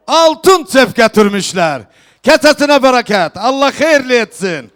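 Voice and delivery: loud festive proclamation to the hall; turkish wedding announcer. Real, not acted. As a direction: call out each gift to the guests. Host proclaims gifts at wedding.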